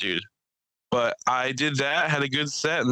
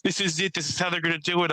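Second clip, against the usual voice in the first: mock yelling; 8 semitones higher, faster than usual. Comedian retelling a man's rant on stage, mock yelling. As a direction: imitate a worked-up ranter, pitched up. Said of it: "The one thing he was yelling".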